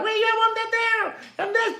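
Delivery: high, hushed Popeye impression; mocking Popeye voice. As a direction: squeak it out like Popeye to mock him. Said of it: "You know Popeye".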